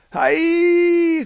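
high alarmed cry; cried out. Acted. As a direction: shout it up at the shadow. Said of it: "cried Harvey".

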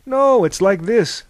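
high, loud, slow eager demo; eager exclamation. A narrator's reading. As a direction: announce it with gusto. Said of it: "said Thales, leaping over".

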